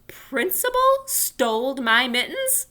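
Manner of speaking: can't believe it; shocked disbelief. Acted. Junie B asking the teacher in disbelief. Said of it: "very shocked".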